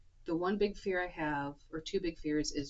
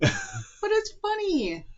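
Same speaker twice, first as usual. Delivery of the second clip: laughing; 8 dB louder than usual. Real, not acted. Laughing while agreeing.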